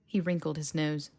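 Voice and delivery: ordinary delivery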